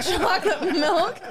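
laughing through the last words; breaking into laughter. Real, not acted. Reading the script to the hosts, cracking up. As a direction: lose it while reading aloud.

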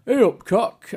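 high-pitched Yorkshire greeting; Yorkshire accent. Shown as a demonstration. Showing friends an English accent, high Yorkshire voice. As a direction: pipe up in broad Yorkshire. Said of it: "I can do a bit of a".

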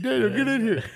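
high, loud summons through laughter; mock calling out. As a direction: call someone in from another room, jokingly. Jokingly calls an absent friend over.

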